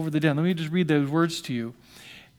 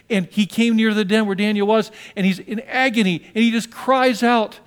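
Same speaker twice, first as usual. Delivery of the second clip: rising, louder preacher telling; preaching at full force; 3 semitones higher, 4 dB louder than usual. Acted. Pastor drawing the congregation into the king's agony, preaching. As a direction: build the scene with mounting urgency.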